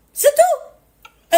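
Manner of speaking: sudden loud high burst; emphatic outburst. Real, not acted. Streamer to viewers, closing the argument with a loud cry. Sums up rules on respect.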